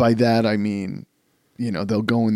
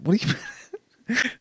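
retold question cracking into laughter; laughing; 11 semitones higher, 5 dB quieter, much faster than usual. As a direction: question broken by a laugh, fast. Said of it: "I'm like".

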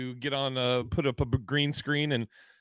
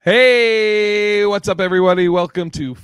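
loud, high podcast welcome; hype intro; 5 semitones higher, 11 dB louder than usual. Acted. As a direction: greet the crowd big.

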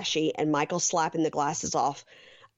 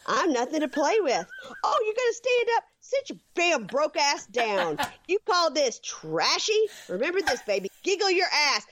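much higher, fierce mock tirade; Angela ranting; 9 semitones higher than usual. Host reading Angela's rant at Michael's friends, high and fierce. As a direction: channel Angela telling off the goofballs. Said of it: "Angela says".

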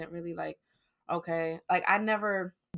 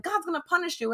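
high, hammed-up warning of doom; dramatic dad; 11 semitones higher, 3 dB louder than usual. Dad warns grieving daughter, high melodramatic voice. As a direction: play the melodramatic father. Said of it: "It was like a novela, yo".

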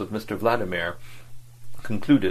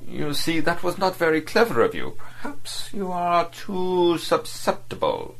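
scornful diplomat; 9 semitones higher than usual. A narrator's reading. Diplomat deriding the fat anarchist, scornful. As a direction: belittle him with contempt. Vladimir lectures Verloc.

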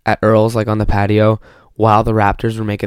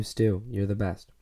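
ordinary talk

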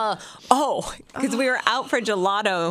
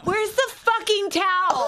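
higher, drawn-out imitation of a small child; swearing little kid; 10 semitones higher, slower than usual. Mom imitating her swearing toddler, childlike demand. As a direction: mimic a kid's indignant demand. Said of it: "And he's like".

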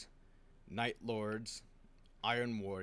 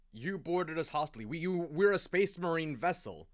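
higher and louder, angry and accusing; indignant shout; 8 semitones higher, 10 dB louder than usual. Acted. Garrow confronting the Imperial Fists over the boarding, indignant shout. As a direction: call them out, outraged. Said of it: "Garrow's like".

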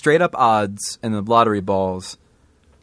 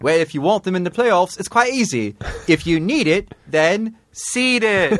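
infomercial pitch; 7 semitones higher than usual. Pretend.

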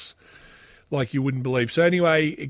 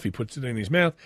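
usual speaking voice

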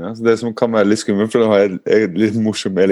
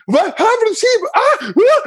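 very high, shrieky alarm; hammed-up imitation of a frightened partner; partner's panicked voice. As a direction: fire off horrified questions in a squeal. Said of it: "frykten som da samboeren min fikk".

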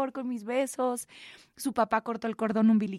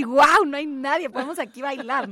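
thrilled; 3 semitones higher, 8 dB louder than usual. Retold or imitated. Dreamy empty hospital thought.

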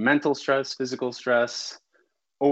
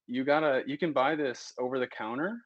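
ordinary talk

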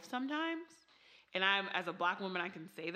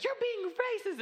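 high mock accusing mother; 5 dB louder than usual. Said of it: "she's like".

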